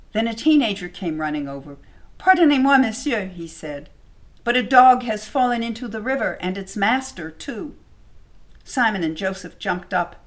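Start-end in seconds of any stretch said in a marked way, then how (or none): 2.2-3.3 high, loud, breathless plea; urgent call. Acted. Teenager runs over alarmed. 4.4-7.7 higher and louder, pressing; urgent. Acted. Teenager reports the accident.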